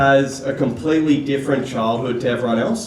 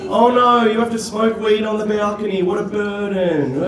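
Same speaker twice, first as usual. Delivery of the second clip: high, mock-sorrowful sympathy; sarcastic pity; 4 semitones higher than usual. Older sibling to spoiled little sister, sarcastic mock sympathy. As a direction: pitch it up in fake pity, dragging out the end.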